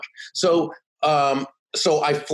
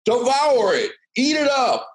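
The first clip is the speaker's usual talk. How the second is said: mock speaker hammering home the twist; Charlie Jones; 13 semitones higher than usual. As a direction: urge the crowd on like a revival showman. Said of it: "a Charlie Jones imitation".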